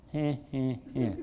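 soft, low, grudging heh-heh; half-hearted forced chuckle; cynical token laugh. Acted. Cynic made to laugh, cynical half-hearted chuckle. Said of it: "the cynical person goes".

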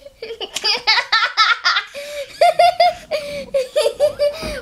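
long high giggling fit; high-pitched peals of laughter; uncontrollable laughing. Real, not acted. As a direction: keep laughing, helpless and high. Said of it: "We're still going".

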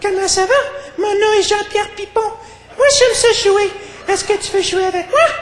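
put-on tiny, very high-pitched French boy; high-pitched French-speaking child; little French kid. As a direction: squeak it out like a small Québécois kid. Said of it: "this little French kid's on the bench".

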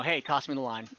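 ordinary talk, nothing marked

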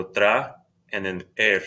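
"tra" and "er" each said apart; separate syllables. Shown as a demonstration. Teacher splitting traer for learners, tra and er as separate syllables. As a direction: break traer into tra, then er. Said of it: "are separate syllables".

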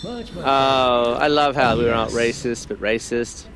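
ordinary talk, nothing marked

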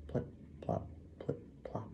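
soft, whispered water drips; hushed imitation of dripping oars; whispered dripping sounds. A narrator's reading. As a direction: whisper each drip, separate and tiny.

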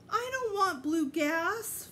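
high, slow whining protest; whiny wail. A narrator's reading. Fudge protesting to Mom that he doesn't want blue gas, whining. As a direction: wail it like a scared little kid. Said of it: "Fudge cried".

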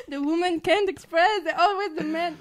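loud laughing teasing complaint; laughing protest. Real, not acted. Guest laughingly scolding host for talking over women. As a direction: laugh it out while joking about men.

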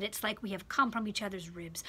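whispered aside about shared ribs. Real, not acted. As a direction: breathe it out like a confidence. Intimate point in sermon.

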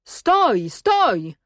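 high call, each word dropping sharply; ringing halt call. A narrator's reading. Cossacks halt a rider in the forest, high ringing call. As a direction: call a halt that echoes through woods. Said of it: "rozległo się i echami rozbiegło".

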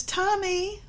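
high sing-song call of Tommy, rising at the end; a mother lilting "Tommy" upward like a gentle sing-song call; mother calling sweetly. Shown as a demonstration. A mother calling her son sweetly, a high rising sing-song. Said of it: "the emphasis in the tone of voice".

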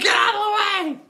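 high, loud squawking warning; frantic parrot. Acted. Parrot bolting from the table.

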